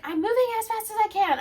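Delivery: imitating a friend's high complaint; whiny protest. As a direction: whine that you're already hurrying. Friend protesting while running.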